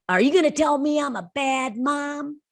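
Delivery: high defensive snap, a mother on guard. Retold or imitated. High defensive snap from a mother expecting a scolding. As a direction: bristle back as if accused. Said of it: "she snapped her head like".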